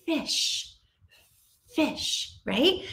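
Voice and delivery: "Fish," whispered, with a long breathy f; whispered demo. Shown as a demonstration. Teacher showing pupils how to make the f sound in "Fish,". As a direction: breathe out "Fish," to show the lips. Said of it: "you're gonna show them".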